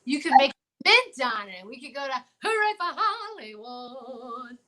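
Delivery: ordinary talk, nothing marked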